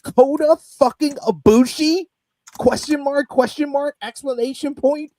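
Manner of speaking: excited disbelief. Retold or imitated. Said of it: "I literally have my notes".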